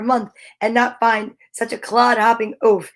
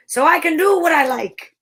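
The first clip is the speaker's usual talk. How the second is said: higher, defiant storybook retort; mild shout back; 5 semitones higher than usual. A narrator's reading. Giant answering the angry chief, mild shout back. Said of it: "he shouted back".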